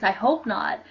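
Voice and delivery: ordinary talk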